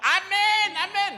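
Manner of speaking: shrill, loud, mocking amen; loud sarcastic amen meaning get it over with. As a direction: hurry the preacher with a teasing amen. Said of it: "Aminnya itu ngeledek".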